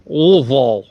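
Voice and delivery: LOUISVILLE swallowed into a muffled "OO-vul", loud; mouth-stuffed pronunciation. Shown as a demonstration. Friend's tip on saying Louisville, mouth-stuffed and slurred. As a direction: say Louisville as if a fist fills your mouth. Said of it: "stuff your whole fist in your mouth and just go".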